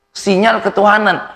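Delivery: preached punchline; proclaimed. Shown as a demonstration. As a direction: answer it high and loud: "Sinyal ketuhanan" ringing out. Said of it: "dengan apa?".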